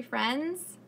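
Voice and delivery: ordinary delivery, nothing marked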